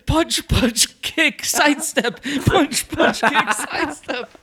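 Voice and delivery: high, chanted combo cracking up; mock workout instructor. Joking about Tai Bo technique, high and giggly. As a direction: call out the moves through laughter.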